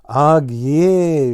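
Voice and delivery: loud high shout of the made-up word. A narrator's reading. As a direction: call out Ag yea loudly. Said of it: "shouted Blue Jay".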